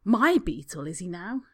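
slow, loud, stung sarcasm. Acted. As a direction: throw it back, hurt.

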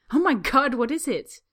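high, loud panicked cry. Acted. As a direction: cry out in alarm. Panicked guest at a rat.

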